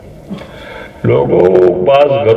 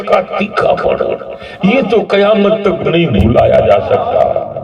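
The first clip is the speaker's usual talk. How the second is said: mother's voice; 7 semitones higher than usual. Acted. Mother telling her son how bitter death was, high and pained.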